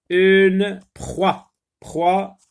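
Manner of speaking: high, loud dictation of proie. Shown as a demonstration. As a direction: call proie out clearly. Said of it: "d'une seule syllabe".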